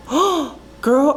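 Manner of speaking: ordinary delivery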